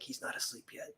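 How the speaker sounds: hushed whisper, barely a breath. Retold or imitated. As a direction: whisper it so the ogre won't wake. Whispering while escaping the ogre.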